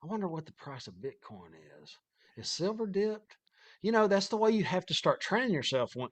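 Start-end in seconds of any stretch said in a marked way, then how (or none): 0.0-3.4 inner thought. Retold or imitated. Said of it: "I'm thinking".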